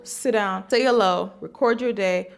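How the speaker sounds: usual speaking voice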